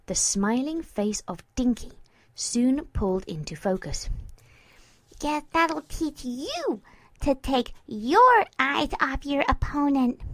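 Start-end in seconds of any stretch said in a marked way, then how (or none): 5.2-10.2 sing-song mimicking. Mare pinning her friend, mimicking him in sing-song. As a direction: parrot it back in a teasing sing-song. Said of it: "mimicked him in a sing-song voice".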